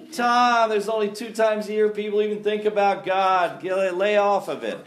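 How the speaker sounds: high, loud complaining objector; mock exasperated objection. Churchgoer telling Tom to back off, mock exasperation. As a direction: protest loudly, as if imitating a fed-up churchgoer.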